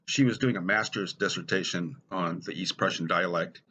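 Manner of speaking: ordinary talk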